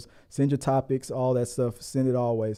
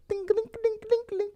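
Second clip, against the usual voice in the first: mock bell; 7 dB quieter than usual.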